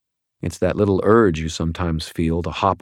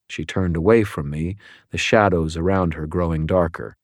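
ordinary delivery, nothing marked

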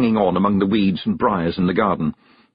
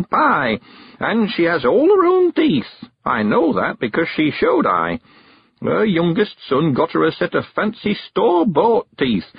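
put-on high old countryman voice; old man sending the girl on an errand, high and eager; Mister Weevil; 9 semitones higher, 3 dB louder than usual. A narrator's reading. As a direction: lift into a high, chatty old man's voice.

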